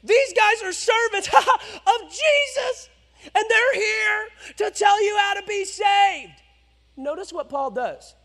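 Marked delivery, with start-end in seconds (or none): 0.0-6.4 high, slow shrill announcing cry; mock slave girl. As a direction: mimic the girl's loud proclamation. Mocking slave girl's cry.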